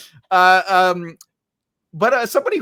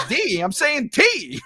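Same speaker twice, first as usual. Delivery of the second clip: mock Brooklyn accent; 10 semitones higher, much slower than usual. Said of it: "That's my Brooklyn accent".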